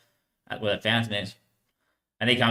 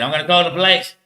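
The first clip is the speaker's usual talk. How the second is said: retold warning, higher and louder; raised-voice threat; 5 semitones higher, 6 dB louder than usual. Shop worker calls after departing thief, loud imitation.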